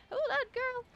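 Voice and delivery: high, playful holler to hold on; squeaky-high put-on interjection. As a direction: pitch it way up, mock-sassy. Same high put-on voice, talking back.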